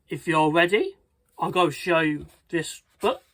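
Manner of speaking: usual speaking voice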